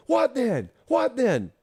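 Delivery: cheering on the fans; hype cheer. Retold or imitated. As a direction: pump up the crowd with a high call.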